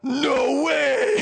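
loud, high, drawn-out amazement; excited disbelief. Retold or imitated. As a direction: imitate someone thrilled by a surprise gift. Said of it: "she's so excited".

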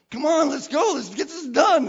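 loud, high rallying call; pumped-up, pushy urging; fired up. Retold or imitated. Acting out his impatient eagerness.

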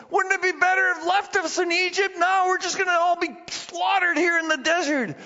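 high-pitched panicked complaint; mock freaking out. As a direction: imitate Israelites wailing their protest to Moses. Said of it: "they start freaking out".

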